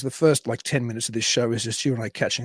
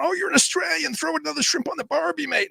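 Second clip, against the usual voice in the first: much higher mock fan voice gushing the slogan; imitating eager foreign fans. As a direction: squeal it like a tourist who just met an Aussie. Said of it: "from people going".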